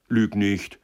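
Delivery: loud, sharp reprimand; fierce, emphatic command; vehement. A narrator's reading. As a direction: cut in forcefully. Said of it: "rief Türkisch Gelb heftig".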